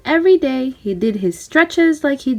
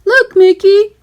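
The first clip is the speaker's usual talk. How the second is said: higher, louder goofy call; excited Goofy; 9 semitones higher, 7 dB louder than usual. A narrator's reading. Goofy showing Mickey the rock, higher and louder. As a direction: point it out excitedly.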